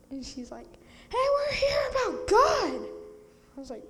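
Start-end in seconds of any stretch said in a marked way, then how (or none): 1.1-2.9 high, loud scolding shout; teacher's yell. Retold or imitated. Camper imitates teacher yelling at talking kids, high and loud. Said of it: "my teacher yells".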